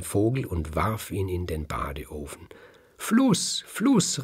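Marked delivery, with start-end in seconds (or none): 3.0-4.2 very high-pitched rooster calling out; urgent cry for help. A narrator's reading. Rooster in the oven calling the river, urgent cry. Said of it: "rief der Hahn ganz schnell".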